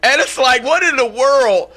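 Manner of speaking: radio host railing in disbelief at a fake identity; incredulous outrage. Real, not acted. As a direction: pitch it up in disbelief at the absurdity. Incredulous rant at the impostor.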